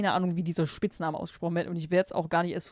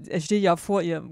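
ordinary talk, nothing marked

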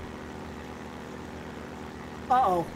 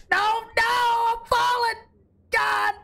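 panicked; 11 semitones higher, slower than usual. Real, not acted. Panicked cry while falling in game.

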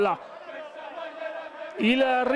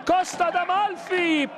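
excited; 6 semitones higher than usual. Real, not acted. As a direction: build to a shout as the cross comes in. Goal build-up in live commentary.

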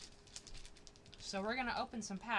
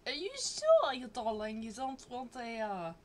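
put-on much higher, louder teasing Japanese accent, breaking into laughter; mock Japanese accent; 5 semitones higher, 4 dB louder than usual. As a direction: push the silly Japanese accent again, laughing. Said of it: "Stop with the accent".

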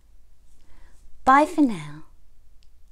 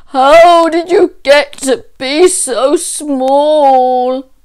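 bawling bear; 3 semitones higher, 10 dB louder than usual. A narrator's reading. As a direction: bawl it out across the whole wood. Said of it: "hear the voice bawl".

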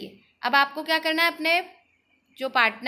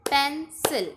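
Pencil in two separated beats; split into syllables; slower than usual. Shown as a demonstration. Teacher leading a clapping drill, pencil split in two. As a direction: leave a clear gap inside pencil. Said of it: "partition में इसे बोलना है".

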